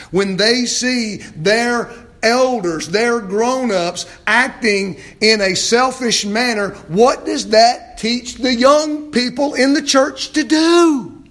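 loud, high, drawn-out preaching; impassioned preaching. Real, not acted. Preacher challenging the grown-ups about the young people, impassioned. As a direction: build slowly to a ringing shout.

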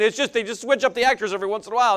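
ordinary talk

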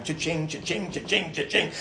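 quick rhythmic cha-ching noises; imitated cash register. Dad watching book purchases ring up, imitated till. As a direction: mimic a till ringing up items.

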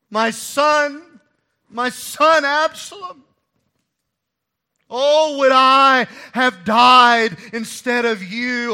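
high, loud, slow wail; wailing lament.

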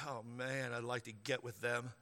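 mock lustful murmur. Pastor imitating a congregant's lustful thought, soft. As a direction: murmur it like a guilty private thought. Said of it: "You ever looked at anybody and said".

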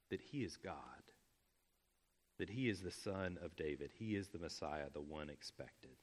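dropping to a near whisper; hushed. Real, not acted. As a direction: hush it like a revelation. Awe-filled softening on Messiah claim.

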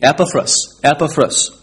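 Epaphras with stress up front; first syllable stressed in Epaphras; correct pronunciation. Shown as a demonstration. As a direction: say Epaphras as EP-uh-fruss. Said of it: "you'll be able to say".

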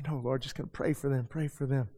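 soft hushed prayer to himself; pious murmur. Retold or imitated. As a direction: drop to a meek private murmur. Pious self-correction after the urge.

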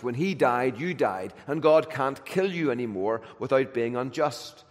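normal talking voice

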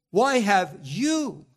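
slow, loud, dragging "Why have you?"; slow anguished question. Acted. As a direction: stretch every word as if you cannot believe it. Same cry repeated.

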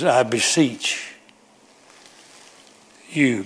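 I beseech drawn out slowly with a long pause before you. Shown as a demonstration. Preacher pleading with the congregation, slow drawn-out I beseech. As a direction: stretch I beseech, hang, then land on you. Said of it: "Got your attention?".

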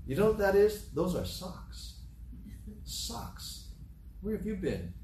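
mock smart-aleck explaining, dropping to a hush. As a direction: imitate a cheeky husband spelling out the obvious. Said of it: "say in a smart, aleck way".

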